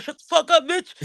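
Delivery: high, dismissive mimic of Henry; mock rude brush-off. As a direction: toss it off like a rude friend. Quoting Henry telling him off.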